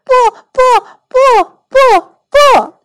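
very high, loud staccato protest; little boy screaming his refusal; shouting child. A narrator's reading. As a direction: yell each word like a boy in a tantrum. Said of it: "奥利弗大喊大叫".

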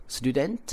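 student lifted up at the end; teacher models a yes-no question rise; rising student. Shown as a demonstration. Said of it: "升调".

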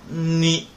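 你 with a long hummed n before ni; drawn-out nnn-ni on 你; wrong nasal onset. Shown as a demonstration. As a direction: show the mistake in 你 by leaning on the nasal. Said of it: "不要读成你".

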